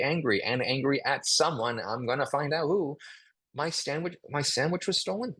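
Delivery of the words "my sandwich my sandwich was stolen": a touch of sadness. Shown as a demonstration. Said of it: "pretty sad".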